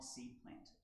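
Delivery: normal talking voice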